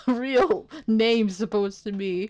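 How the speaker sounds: laughing. Real, not acted. Giddy reaction to name reveal.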